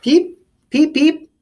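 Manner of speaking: very high loud peeps; peeper call. Acted. The peeper frog calling in the story, very high and loud. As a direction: chirp like a tiny frog.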